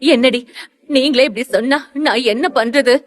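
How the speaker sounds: high, crying protest; tearful. A narrator's reading. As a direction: plead helplessly through acted tears. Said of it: "அவள் இன்னும் அழுதாள்". (அழுகையுடன் உயர்ந்த குரலில்)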